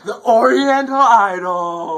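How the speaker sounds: high, loud, slow announcer voice; game show announcer. Acted. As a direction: boom out the show's name.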